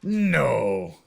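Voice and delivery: drawn-out, falling no; imitated Belgian refusal. Imitating Belgian French speakers, long dismissive no. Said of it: "they would make this sound".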